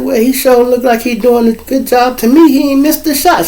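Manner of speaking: lightly voiced teasing girl; teasing friend.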